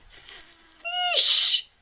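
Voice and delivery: high, strained dragon hiss. A narrator's reading. Dragon hissing at Hiccup for fish. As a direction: hiss it like a hungry little dragon. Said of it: "hissed Toothless".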